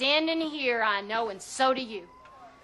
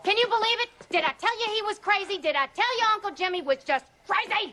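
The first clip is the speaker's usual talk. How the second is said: high, agitated rant; agitated and incredulous; worked up; 8 semitones higher, faster than usual. Acted. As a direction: fire off indignant questions, pitch way up. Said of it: "Mary Elizabeth, calm down".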